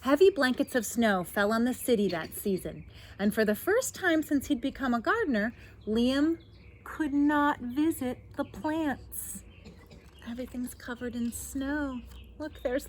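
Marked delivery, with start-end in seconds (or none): 0.0-9.4 lively, projected read-aloud with lifted key words; picture-book narration. A narrator's reading. Reader tells children of a snowed-in gardener, animated storytelling. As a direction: read it out brightly for young listeners.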